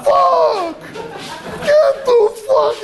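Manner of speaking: very high, drawn-out mock yell; hammed-up squeal of stunned disbelief; shocked stock boy. Stock boy walking in on the couple, mock astonishment.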